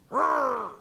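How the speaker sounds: a short hammed-up growling roar; mock roar. Preacher mimics Jonah's anger, a mock roar. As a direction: roar like an angry prophet.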